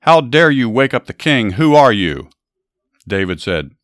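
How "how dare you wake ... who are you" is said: louder, indignant call back across the night; yelling back. A narrator's reading. Abner shouts back at the stranger who woke the king, indignant yell. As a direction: bark it back, outraged at being woken. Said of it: "then yelled back".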